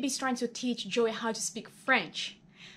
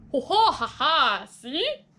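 gloating laugh; 3 semitones higher, 6 dB louder, slower than usual. Acted. Joey gloating laugh to Phoebe.

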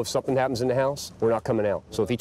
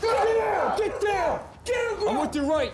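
cover me shout; slower than usual. Real, not acted. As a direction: command the suspect down hard. Officer ordering a suspect down.

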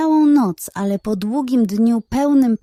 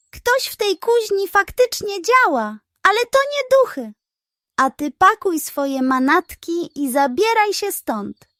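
detective's voice; 9 semitones higher than usual. Acted. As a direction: voice the pig detective, confident and accusing. Detective dismisses ghosts, expels charlatan.

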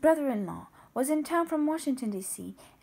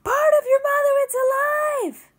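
very loud, much higher shout; yelling; 13 semitones higher, 11 dB louder than usual. Acted. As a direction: call out across the house. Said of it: "yelling for Lawrence".

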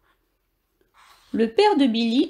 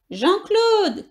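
calling out from tree; 8 semitones higher than usual. Acted. Hamster in a tree shouts down to his friend. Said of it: "crie-t-il".

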